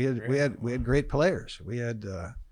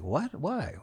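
high, swooping incredulous questions; retold disbelief; 6 semitones higher, 5 dB quieter than usual. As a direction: act out baffled protest at bad news. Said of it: "And I'm like".

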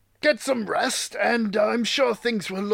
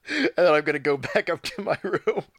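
normal talking voice

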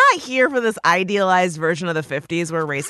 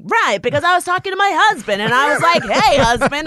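mock sitcom delivery; 5 semitones higher, 4 dB louder, faster than usual. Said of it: "She's like".

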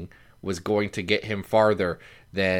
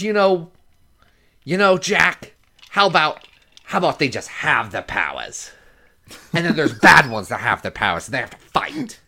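put-on higher, louder old showman voice; Stan Lee impression; 5 semitones higher, 4 dB louder than usual. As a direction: play Stan pitching an idea to Jack. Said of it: "I said".

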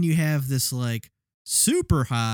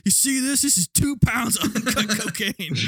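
laughing through a tough-guy boast; Tom Sizemore impression; 9 semitones higher than usual. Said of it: "It was like".

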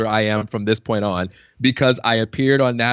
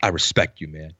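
mock serious; 7 semitones lower, 4 dB quieter than usual. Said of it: "he's all serious".

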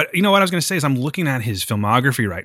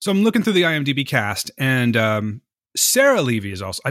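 normal talking voice

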